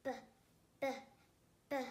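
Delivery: "B" as a short "buh", not "bee"; phonics B sound. Shown as a demonstration.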